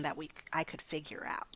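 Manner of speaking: a near-whispered admission, breath only. Real, not acted. To the host, admitting she couldn't fix it, whispered. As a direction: let the words fall away to a whisper. Trailing off on painful memory.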